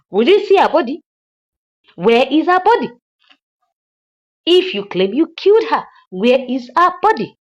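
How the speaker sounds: mock shouting for the missing body; imitating furious demand. Madam Uchechi demanding the body from the hitman, mock shout.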